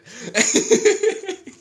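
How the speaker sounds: a loud shrieking laugh way up high. Real, not acted. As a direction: crack up loudly. Laughing at own rant.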